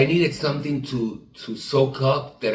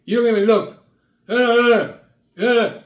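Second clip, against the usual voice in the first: bouncer; 9 semitones higher, 6 dB louder than usual. Retold or imitated. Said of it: "He goes".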